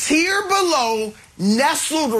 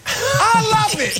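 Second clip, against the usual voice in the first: high, slow, relishing each word; gleeful gloat; 9 semitones higher, slower than usual. Real, not acted. Pundit gloats to Nick over his team's ranking, high and slow.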